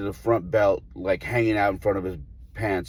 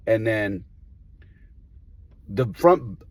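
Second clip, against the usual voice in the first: ordinary talk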